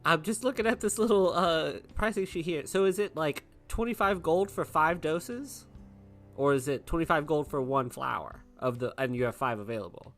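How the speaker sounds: normal talking voice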